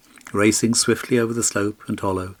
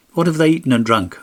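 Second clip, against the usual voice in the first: loud, higher sharp question; demanding king; 7 semitones higher, 6 dB louder than usual. A narrator's reading. King demanding to know what the ponies ate, loud. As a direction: press him hard.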